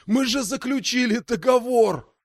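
high, frantic sorcerer plea; desperate pleading. Acted.

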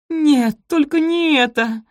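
high, loud, slow plea; horrified. A narrator's reading. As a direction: wail it in horror. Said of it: "ужаснулась Софи".

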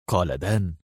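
plain reading voice

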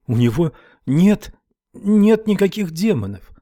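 put-on high, scared protest of a girl; girl facing a rifle, scared denial; frightened denial. A narrator's reading. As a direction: deny it through fear and tears. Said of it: "не хотела, чтобы Уитни заметил её страх".

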